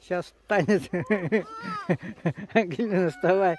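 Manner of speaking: loud, high call with a laugh. Real, not acted. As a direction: call encouragingly across the hill. Calls fallen daughter on slope. (громко зовёт дочь встать)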